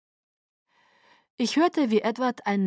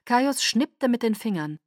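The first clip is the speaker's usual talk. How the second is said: usual speaking voice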